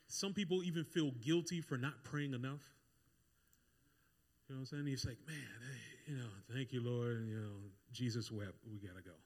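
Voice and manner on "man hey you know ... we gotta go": low, quiet, going-through-the-motions prayer; mock a prayer with no excitement, voice sinking away; unexcited prayer. Preacher imitating someone praying without excitement, listless and low. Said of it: "it's like there's no excitement".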